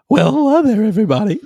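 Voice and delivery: lightly put-on cheery hero greeting; new Batman voice. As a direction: greet the crowd as a jolly superhero. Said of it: "What should he sound like?".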